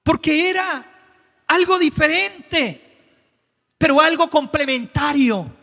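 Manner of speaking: fervent revival preaching; preaching at full force. Acted. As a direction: stir the crowd up. Preacher shouting with music.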